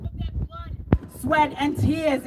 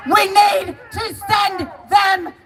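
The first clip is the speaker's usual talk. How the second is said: slow, drawn-out rallying cry; emphatic slow crowd-rouser; rallying shout; 3 semitones higher, slower than usual. Real, not acted. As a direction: shout it word by word to start a chant.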